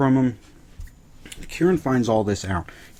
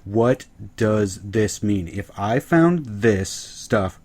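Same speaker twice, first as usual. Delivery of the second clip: slow, deliberate leading question; drawn-out, heavily pointed delivery; much slower than usual. Retold or imitated. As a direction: spell it out word by word. Slow pointed retold question to girls.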